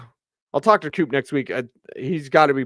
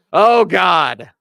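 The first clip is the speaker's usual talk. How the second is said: exasperated groan; 6 semitones higher, 9 dB louder than usual. Retold or imitated. Mimicking the coach's eye roll.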